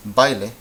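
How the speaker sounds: BAILE with a and i glided into one syllable; diphthong pronunciation. Shown as a demonstration. Teacher contrasting two Spanish words for learners, diphthong pronunciation. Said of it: "The a and the e together make".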